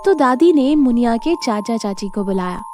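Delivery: ordinary delivery, nothing marked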